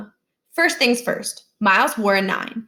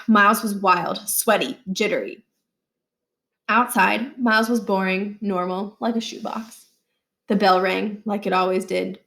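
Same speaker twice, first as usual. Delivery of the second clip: usual speaking voice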